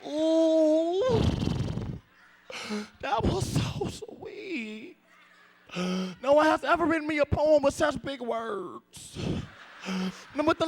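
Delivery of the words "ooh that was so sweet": swooning. Acted. Touched girlfriend.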